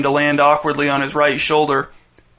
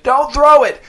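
high, loud panicked yell; strangled shout; 11 semitones higher, 6 dB louder, slower than usual. A narrator's reading. Dez in a headlock begs Jace not to throw, strangled shout. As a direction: scream it while being choked. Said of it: "his voice strangled, shouted".

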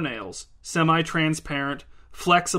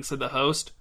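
ordinary delivery

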